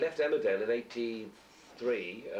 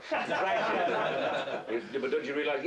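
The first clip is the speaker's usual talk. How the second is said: words bubbling with laughter; laughing through a funny anecdote; laughing while telling; 3 semitones higher, 3 dB louder, much slower than usual. Real, not acted. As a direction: laugh through the punchline of the story. Laughing while retelling Lucy scene.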